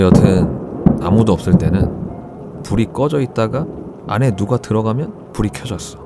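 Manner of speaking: ordinary delivery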